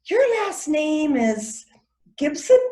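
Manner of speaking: high, slow, drawn-out lead-in to a questioning Gibson; intrigued recognition. Acted. New acquaintance recognising a surname, pleasantly surprised. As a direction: lift it high with dawning interest.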